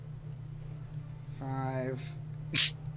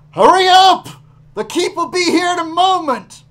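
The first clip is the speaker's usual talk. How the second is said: booming distant shout, high and loud; projected shout; 11 semitones higher, 13 dB louder than usual. Acted. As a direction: call down from the top of the stairs. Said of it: "that same projected voice".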